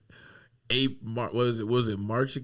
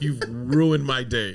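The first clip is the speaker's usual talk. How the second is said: normal talking voice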